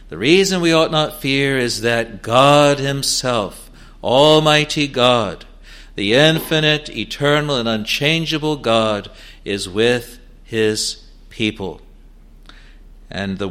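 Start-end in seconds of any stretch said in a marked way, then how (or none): none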